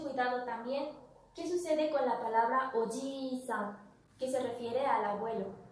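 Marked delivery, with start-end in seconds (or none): 2.7-3.8 "oji-san" with a long held I, ojiii-san; i alargada. Shown as a demonstration. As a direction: stretch the middle vowel of "oji-san". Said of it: "se produce un alargamiento sobre la vocal". ("oji-san" con la i alargada, ojiii-san)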